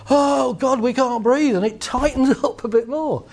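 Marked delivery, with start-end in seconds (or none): 0.1-1.6 mock panic. Voicing panicking mind.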